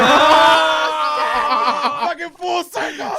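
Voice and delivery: high, loud drawn-out wail; hammed-up outcry. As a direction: cry out in comic shock. Shouted reaction in story.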